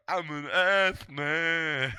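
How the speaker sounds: high, loud, very slow caricature; hammed-up drawn-out character line; mocking character impression. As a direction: stretch it out in a goofy voice.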